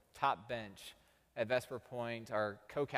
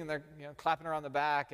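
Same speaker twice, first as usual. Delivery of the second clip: usual speaking voice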